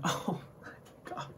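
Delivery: slow, hushed whisper of disbelief; awed whisper. Real, not acted. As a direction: whisper it slowly, overwhelmed by how good it is. Overwhelmed by the show he praises.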